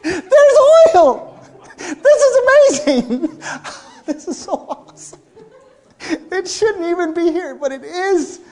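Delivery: a woman overjoyed at the flour jar; excited Baptist. Acted. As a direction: squeal with joyful disbelief. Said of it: "excited Baptist".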